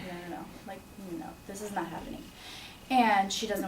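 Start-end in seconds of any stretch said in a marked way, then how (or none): 0.1-2.2 softer, fast, breathless disbelief; murmured rush of no, no; stunned denial. Retold or imitated. Daughter watching her mother's monitor flatline, soft fast denial.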